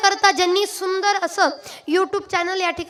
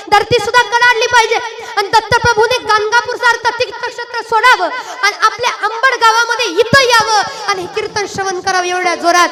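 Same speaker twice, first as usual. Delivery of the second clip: louder, rising preacher's urging; rousing exhortation; 5 semitones higher, 11 dB louder than usual. Real, not acted. Kirtankar firing up the congregation to shout. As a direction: whip the crowd up. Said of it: "urging crowd to chant loudly".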